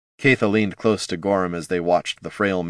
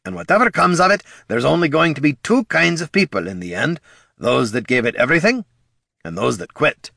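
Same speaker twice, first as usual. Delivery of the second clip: gruff dwarf voice; 7 semitones higher than usual. Acted. Dwarf speech to ranger.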